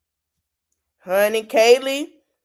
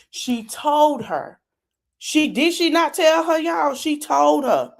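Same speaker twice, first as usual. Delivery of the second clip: higher, pressing each told; real worked-up insisting; emphatic insisting; 7 semitones higher than usual. Real, not acted. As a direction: make the viewers agree with you. Insisting to viewers.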